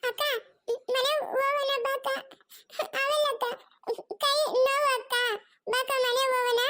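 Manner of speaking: put-on high, tearful child pleading with big sister; crying little child. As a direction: sob and whimper between short phrases. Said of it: "ಅವ್ನ್ ಅಳ್ತಾವ್ ನೋಡ್ರಿ".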